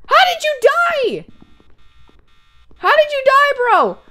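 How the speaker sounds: high-pitched, disbelieving question; incredulous outcry. Real, not acted. Player asking a teammate how he died, incredulous high cry. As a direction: demand it in high, stunned disbelief.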